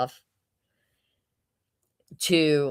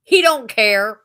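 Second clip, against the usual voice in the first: mock toddler retort, loud and higher; defiant toddler; 5 semitones higher, 9 dB louder, slower than usual. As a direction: answer back like a sassy little kid. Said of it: "and she goes".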